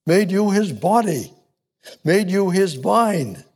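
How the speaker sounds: high, building preacher's cadence; fervent preaching. Acted. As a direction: preach each line like a rising refrain. Preaching with rising fervour.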